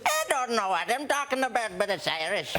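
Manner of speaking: high-pitched, hopelessly fake Irish accent; bad Irish accent. Shown as a demonstration. Comic showing his last bad accent, mock Irish. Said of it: "and then I can do that one".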